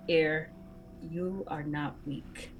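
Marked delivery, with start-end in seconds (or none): 1.1-2.5 soft and slow, for her ear alone; acted whisper. Player's character confides during a hug, whispering. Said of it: "I'm gonna whisper in her".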